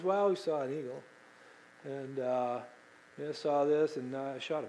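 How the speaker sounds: flat, quiet offhand report; unexcited. Retold or imitated. Teenage son telling dad about his bear, offhand. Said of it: "He was not excited".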